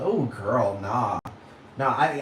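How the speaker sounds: ordinary talk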